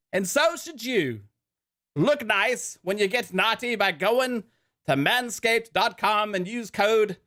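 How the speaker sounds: high-pitched mock British ad read, slipping toward Australian; put-on British accent. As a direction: read the sponsor copy in a hammed-up British accent. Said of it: "do it in a British accent".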